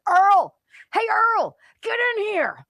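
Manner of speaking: loud high shout across the room; calling out. Pretend. Host acting a panicked official yelling for Earl. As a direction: yell for Earl to get in here. Staged planning meeting, calling out.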